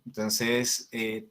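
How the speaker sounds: ordinary talk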